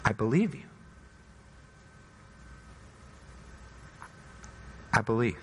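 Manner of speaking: hushed, tender faith; soft confession. Acted. The believer answers Jesus softly and sincerely. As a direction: soften to a quiet vow.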